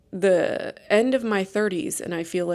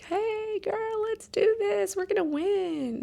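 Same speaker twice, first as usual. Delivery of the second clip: sugary pep talk, much higher and slow; fake cheerful; 12 semitones higher, 4 dB quieter, slower than usual. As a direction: kill her with kindness, over-the-top upbeat mom. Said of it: "killed her with kindness".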